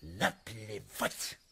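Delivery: slow, dismissive scorn; contemptuous drawl. Acted. Prince spitting on everything, slow and scornful. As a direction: stretch each word out with disdain.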